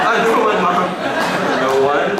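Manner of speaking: put-on high, loud innocent kid; caught kid voice. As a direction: deny it like a caught child. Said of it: "I'm like".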